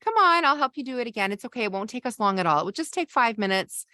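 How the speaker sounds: rushing to fix. Shown as a demonstration. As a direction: rush them past the upset to fix it. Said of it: "And you say".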